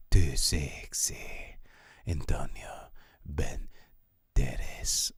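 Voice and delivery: slow, breathy swooning whisper; sultry whisper. Pretend. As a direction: whisper his name like a lovestruck fan. Swooning over Antonio Banderas.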